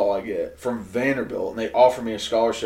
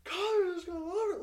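excited friend; 9 dB quieter, faster than usual. Retold or imitated. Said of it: "He's like".

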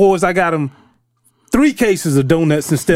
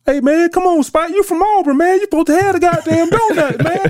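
much higher, mock-scolding big lineman; Big Willie scolding the rookie, higher; scolding teammate; 13 semitones higher than usual. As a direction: chew out the rookie, teasing.

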